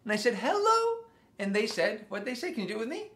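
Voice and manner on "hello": very high-pitched greeting; called-out hello. Retold or imitated. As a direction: call hello right up high. Retold greeting to people in France.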